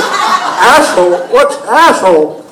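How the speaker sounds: slow, puzzled, oddly stressed; drawn-out swooping question, word by word; Christopher Walken. Retold or imitated. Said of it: "I would do Christopher Walken to him".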